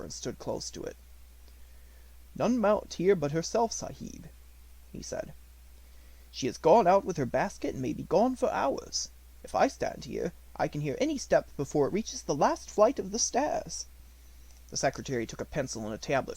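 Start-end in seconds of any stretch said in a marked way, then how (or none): 2.3-4.3 a lightly higher, respectful servant's voice; devoted servant Ramdas. A narrator's reading. Ramdas reports. 6.3-13.9 a lightly higher, respectful servant's voice; devoted servant Ramdas. A narrator's reading. Ramdas reports.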